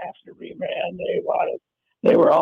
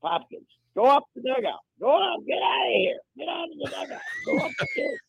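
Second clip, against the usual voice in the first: mock ejection shout; 9 semitones higher than usual. Acting out ejecting a player.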